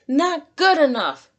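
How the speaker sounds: high, loud barked command; shouted. Acted. Coach SpongeBob yelling at exhausted Gary, loud shout. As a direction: drive the snail harder. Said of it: "shouted his coach".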